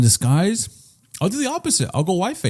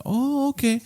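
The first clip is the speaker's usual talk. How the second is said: high, soft "oh, okay"; lightly voiced mom, pitched up and softer; mom's voice; 11 semitones higher, 6 dB quieter, slower than usual. Mom accepting her son's explanation, lightly voiced high mom. Mom's reply in retold story.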